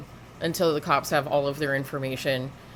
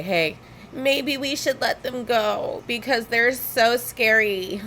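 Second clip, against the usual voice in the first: higher, slower mock officer, lightly voiced; sarcastic cop impression, a bit higher; 5 semitones higher, slower than usual. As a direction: ham up a timid cop's fake fear. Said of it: "get the cops to say".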